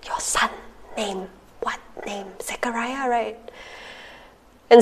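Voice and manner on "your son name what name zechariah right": exaggerated whisper to a mute man. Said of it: "It's like".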